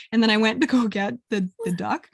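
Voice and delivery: ordinary talk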